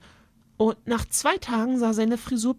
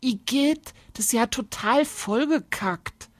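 a hint of a disgusted child, pitched up; higher, mildly indignant young heroine; lightly voiced indignant girl; 5 semitones higher, 3 dB louder than usual. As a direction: play the girl lightly, appalled at the mess.

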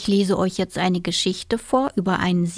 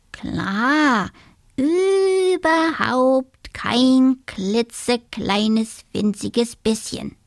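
boastful little dormouse; 4 semitones higher, much slower than usual. A narrator's reading. Dormouse insists he is brave.

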